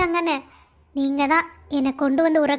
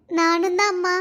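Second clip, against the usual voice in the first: ordinary delivery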